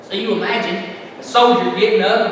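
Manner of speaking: ordinary talk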